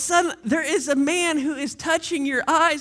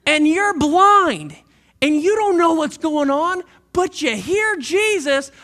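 preaching at full force; 3 semitones higher, 5 dB louder, slower than usual. Acted. Preacher building the scene.

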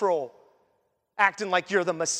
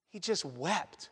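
hushed, slow; 3 semitones lower, 9 dB quieter, slower than usual. Real, not acted. Pausing on Jesus weeping.

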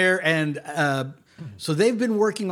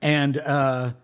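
ordinary talk, nothing marked

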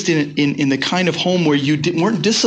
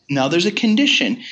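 usual speaking voice

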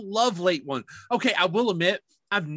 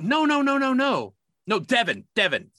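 higher, louder run of eager no's; imitating insistent boss; 7 semitones higher, 3 dB louder than usual. Supervisor correcting the speaker about late ones, higher insistent. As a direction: cut him off, eager to correct.

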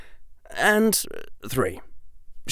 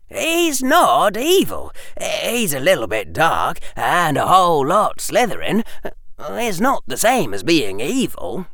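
put-on higher-pitched exasperated boy; 6 semitones higher, 3 dB louder than usual. A narrator's reading. Harry defends the professor to Hermione, exasperated. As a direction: argue back with strained patience, leaning on words. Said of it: "his voice exasperated".

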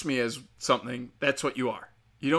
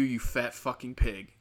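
ordinary delivery

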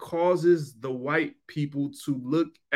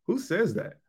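laughing whisper; 5 semitones higher, 5 dB quieter than usual. Real, not acted. Mocking his own baby talk.

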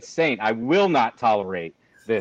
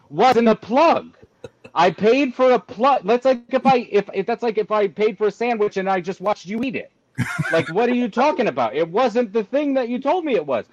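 higher, loud yelling, pushing each point hard; exasperated rant; 10 semitones higher, 3 dB louder than usual. Retold or imitated. Yelling at the dealership staff about the plug, exasperated rant. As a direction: lose it at the dealer over the useless plug.

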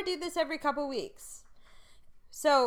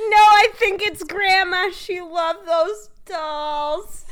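mock mom text; 6 semitones higher, 9 dB louder, much slower than usual. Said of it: "She said".

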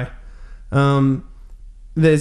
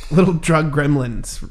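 ordinary talk

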